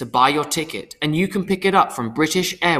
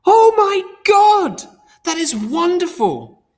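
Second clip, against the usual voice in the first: very high, loud, slow shriek of joy; mother screaming with joy down the phone; joyful scream; 5 dB louder, slower than usual. A narrator's reading. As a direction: scream with delight. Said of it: "The loudest scream came down".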